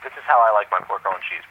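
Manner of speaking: usual speaking voice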